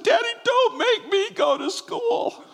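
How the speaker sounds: put-on scared little girl pleading. Crying daughter begging her father at the school door. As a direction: beg your daddy like a frightened child. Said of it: "she looked and said".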